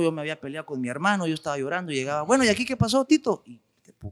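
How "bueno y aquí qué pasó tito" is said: high-pitched mock mom; mother asking. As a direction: play the mother walking in on chaos, pitched up. Mother voiced in retold story.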